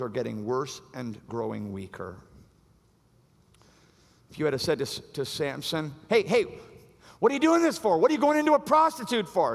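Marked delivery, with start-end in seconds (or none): 6.1-9.6 high, loud pleading challenge; mock confronting shout. Imagined friend challenging Samson, high loud shout. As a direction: call him out hard and high.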